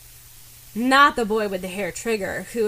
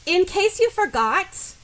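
much higher and slow, spiteful start; vindictive energy; 10 semitones higher, 3 dB louder than usual. Acted. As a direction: wind up to let him have it. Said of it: "a blast of vindictive energy".

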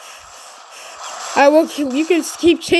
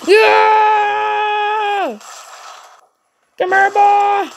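long, higher drawn-out cry of joy; joyful yell; 5 semitones higher, much slower than usual. Real, not acted. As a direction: let the whole yell ring out. Rejoicing in game.

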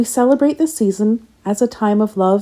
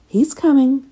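ordinary delivery

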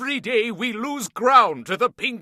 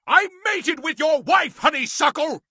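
taunting shout; 5 semitones higher than usual. Acted. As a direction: yell the insult across the room. President taunts general mid-fight.

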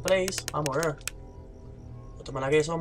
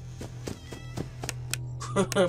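nervous high laugh; 9 dB quieter than usual. Real, not acted. Risa nerviosa tras el grito.

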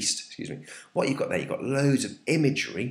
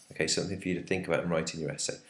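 ordinary delivery

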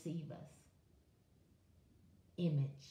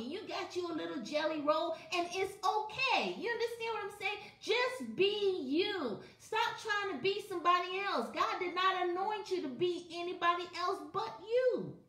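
much higher, rising preacher call; fervent preaching crescendo; preaching climax; 10 semitones higher than usual. Real, not acted. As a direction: build the exhortation up high.

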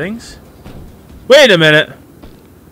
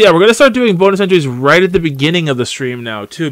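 ordinary talk

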